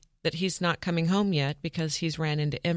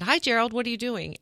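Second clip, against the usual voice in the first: cheery greeting; 7 semitones higher than usual. Retold or imitated. Greeting scammer on phone.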